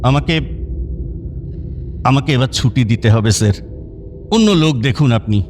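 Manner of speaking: low, halting request to the boss; hesitant plea. Acted. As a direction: nervously ask to be let go. Nervous employee asks boss to release him. (দ্বিধাভরা নিচু গলায় অনুরোধ)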